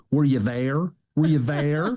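imitating an overemphasizing hillbilly drawl; mild mock Southern twang, every word leaned on; Southern candidate's answer, lightly mocked in hillbilly drawl. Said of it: "hillbilly Gomer Pyle voice".

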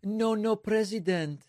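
high-pitched, hammed-up Mexican accent; put-on Mexican-English, pitched well up; mock Mexican accent. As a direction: mimic a bad impression of a Mexican accent. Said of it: "his best impression of a Mexican".

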